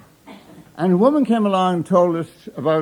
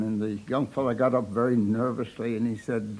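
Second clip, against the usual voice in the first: ordinary delivery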